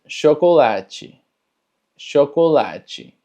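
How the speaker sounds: chocolate as sho-ko-la-chi. Shown as a demonstration. Teacher models Portuguese chocolate as sho-ko-la-chi. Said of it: "Suena más como una S H".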